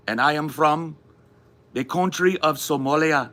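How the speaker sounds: put-on high, formal West African accent. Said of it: "like, a West African accent".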